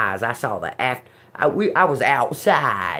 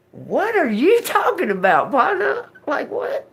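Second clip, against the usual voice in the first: mock disbelief; 12 semitones higher than usual. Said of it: "I look at him like".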